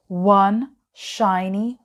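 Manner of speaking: ordinary delivery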